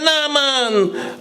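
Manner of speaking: put-on high, drawn-out old woman voice; old lady's refusal. Old lady refusing help on the hill, high voice. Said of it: "She say".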